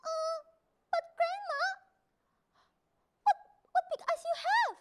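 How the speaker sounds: put-on high, hesitant child voice; a nervous little girl asking; high wary child voice. A narrator's reading. Child questions the wolf.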